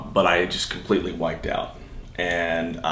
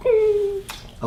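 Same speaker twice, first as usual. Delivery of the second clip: much higher, hammed-up tender reply; mock tender squeak. Son answers his mother's speech, hammed-up high squeak. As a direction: squeak it out like an overwhelmed child.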